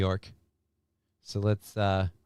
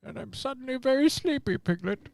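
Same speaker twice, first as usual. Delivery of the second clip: high, soft, sleepy bear voice; impression of a drowsy storybook bear; Winnie the Pooh; 9 semitones higher, 4 dB quieter than usual. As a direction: put on a gentle cartoon bear voice, much higher. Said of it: "Pooh Bear".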